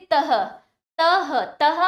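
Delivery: तह ending on an audible h; breathed ha ending. Shown as a demonstration. Teacher demonstrating visarga, तह with a clear ha at the end. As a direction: finish each तह with a puff of h. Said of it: "वहां पर हा का उच्चारण होता है".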